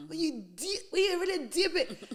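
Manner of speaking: building up, laughing at the punchline. Real, not acted. Friend finishing the point to friends, laughing. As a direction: climb into a laugh.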